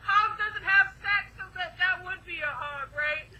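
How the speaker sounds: robot voice broken by laughter; can't hold the voice for laughing. Real, not acted. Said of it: "Like a lamb instead of a robot 'cause I'm laughing".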